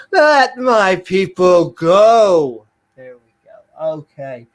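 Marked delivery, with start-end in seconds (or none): 0.1-2.7 loud, high, drawn-out biblical cry; grand, stretched-out call to release the slaves; booming proclamation. Acted. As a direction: belt it out like a prophet, very slowly. Repeats the catchphrase much bigger.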